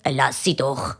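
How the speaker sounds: tiefe, leise Vaterstimme; Papi. A narrator's reading. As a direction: beschwichtige tief und leise. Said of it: "Nachher sagt der Papi". (tüüfi, liisi Papi-Stimm)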